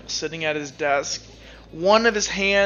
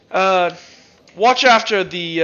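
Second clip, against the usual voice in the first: captain's order; 2 semitones higher, 8 dB louder, slower than usual. Pretend. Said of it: "he speaks around the corner".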